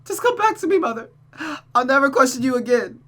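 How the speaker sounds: high, tearful desperate pleading; beg, voice breaking; child pleading for her mother to come back; begging daughter. Acted. Rosa begs Mother.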